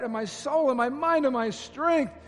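high, loud plea for help, slowing at the end; pleading prayer. Pretend. Congregation praying aloud for help, a loud high plea. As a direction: beg God for help. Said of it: "we're gonna say this to the Lord".

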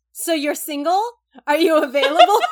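loud laughing mock flirt; hammed-up flirty Haruka.

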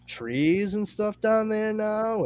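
high, drawn-out bewilderment; mock-baffled robot report; baffled disbelief. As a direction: stretch it out high in puzzled wonder.

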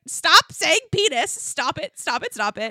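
high, loud, playful protest; mock pleading. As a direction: repeat it in hammed-up helpless exasperation. Retelling her helpless reaction, acted.

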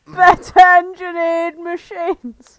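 very slow, high and loud indignant echo; furious outburst. Acted. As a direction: hurl the words back in outrage, stretching each one. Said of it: "Mallard thundered furiously".

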